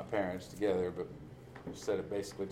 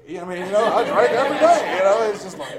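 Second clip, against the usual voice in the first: higher, louder incredulous outburst; mock exasperation; 10 semitones higher, 13 dB louder than usual. Addict picturing life without drink, exasperated. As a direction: throw up your hands in disbelief.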